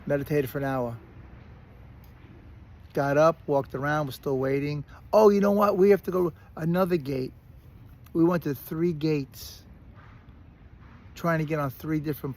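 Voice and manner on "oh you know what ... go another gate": higher, louder mock gate agent; mocking announcement. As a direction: parody the cheerful agent. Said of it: "retold gate announcement".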